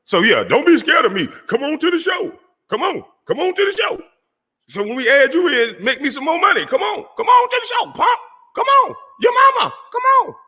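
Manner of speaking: mock taunt. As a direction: goad him in a silly high voice. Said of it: "taunting rival to come on show".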